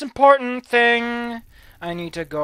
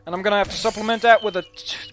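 ordinary talk, nothing marked

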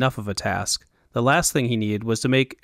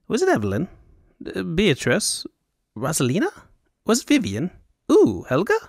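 high Elvunian fop; 6 semitones higher than usual. Acted. Fop guessing names eagerly.

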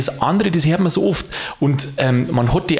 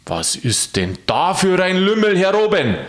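astonished question; 7 semitones higher than usual. Acted. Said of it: "erstaunt fragte".

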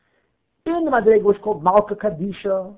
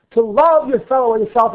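love pressed hard and loud; stress on love; 3 semitones higher, 6 dB louder than usual. Shown as a demonstration. Teacher to students, stressing love. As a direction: lean into the word love. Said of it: "with the emphasis on the word love".

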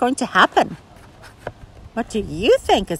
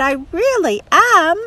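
sorry; 9 semitones higher, 5 dB louder than usual. Acted. Sally apologizing.

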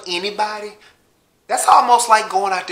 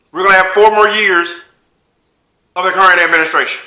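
Obama impression; 4 semitones higher, 8 dB louder than usual. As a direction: lay it on like a former president urging people to vote. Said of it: "What you want Barack to come out and say?".

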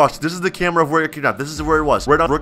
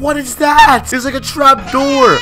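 high, loud alarmed cry; frightened outburst; 9 semitones higher, 5 dB louder, slower than usual. Real, not acted. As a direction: shriek out the discovery. Discovers a trap door.

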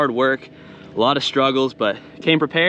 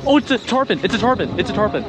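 excited; 8 semitones higher than usual. Real, not acted. Surprise at hooking a tarpon.

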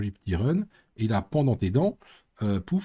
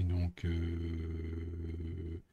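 drawn-out filler; 5 semitones lower, 9 dB quieter than usual. Real, not acted. Drawn out while typing.

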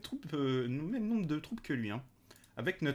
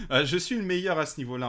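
laughing; 6 semitones higher, 5 dB louder, faster than usual. Real, not acted. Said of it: "Toujours un plaisir, les prononciations".

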